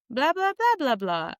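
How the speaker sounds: high, sing-song gibberish; imitated chatter in another language; mock foreign chatter. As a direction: swoop up high on nonsense syllables. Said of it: "saying something to her in Swedish".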